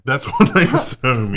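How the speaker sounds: laughing. Real, not acted. Laughing through the words.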